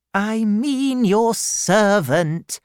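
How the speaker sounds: put-on high, slow, imperious old lady voice; a severe aunt demanding at the door; stern old aunt. Aunt Betsy questions the frightened widow, high and slow.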